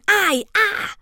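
high yelp of pain; short, high cries; pained cry. A narrator's reading. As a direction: yelp as if landing hard. Mo falls through hole.

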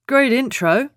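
dry sarcastic quip. Acted. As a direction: say it with a wry edge. Billy's sarcastic remark.

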